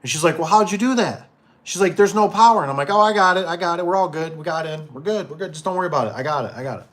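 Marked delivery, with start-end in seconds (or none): 0.4-2.6 high, loud puzzled questions; lady asks the repairman how he got in, high and loud; confused lady. Retold or imitated. Lady questions, voiced higher. 2.9-6.9 higher, quick soothing "I got it"; calm her down fast and keep it light; reassuring. Retold or imitated. He reassures panicking lady.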